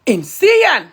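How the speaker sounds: loud, appalled one-word verdict; appalled outburst. Real, not acted. Speaker condemns the man's promotion, appalled. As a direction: burst out with it in disbelief. Outburst after long disgusted pause.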